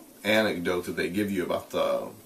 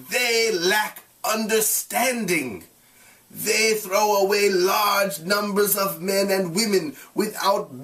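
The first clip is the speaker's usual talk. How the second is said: raised, measured read-aloud voice; story narration; 9 semitones higher, slower than usual. A narrator's reading. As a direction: read the passage to the class. Reads novel aloud to class.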